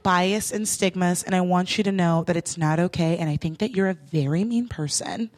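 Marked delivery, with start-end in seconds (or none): 1.2-5.3 low, composed reproach; chill, earnest speech. Retold or imitated. Closing her speech to the cab driver, chill. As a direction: keep it low and solemnly hurt. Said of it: "very chill words".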